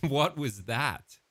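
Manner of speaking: laughing, drawn-out incredulous question; amused disbelief. Real, not acted. Listener laughing at the AI's accent attempt, amused disbelief. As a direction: ask it through laughter, slowly.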